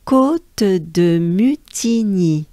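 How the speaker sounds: Côte de Mutigny said slowly, kot-de-mü-ti-nyee; slow French pronunciation. Shown as a demonstration. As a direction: demonstrate the whole name slowly. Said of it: "as a whole, we have".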